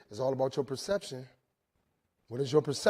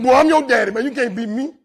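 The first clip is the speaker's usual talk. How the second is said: proud dad; 9 semitones higher, 15 dB louder than usual. Retold or imitated. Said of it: "I said".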